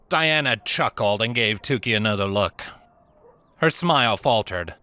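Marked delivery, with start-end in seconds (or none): none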